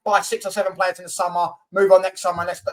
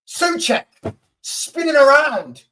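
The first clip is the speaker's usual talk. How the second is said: high, slow mock commentary of the spin; 6 semitones higher, slower than usual. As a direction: send him up, drawn out and high. Mocking the defender's spin.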